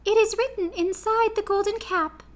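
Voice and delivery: Queen of the Mice to her friends, squeaky and kind; squeaky little voice. A narrator's reading.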